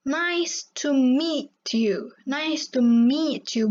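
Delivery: slow nice to meet you, meet with a long ee; slow model pronunciation. Shown as a demonstration. Teacher modelling meet for students. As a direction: say meet long and clear, not met. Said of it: "tapi meet you gitu kan".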